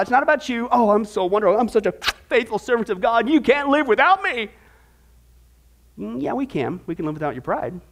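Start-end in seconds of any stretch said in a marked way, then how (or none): 0.7-4.5 hammed-up self-admiration, rising to a higher finish; mock boasting. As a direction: gush about yourself in parody. Mocks a proud servant.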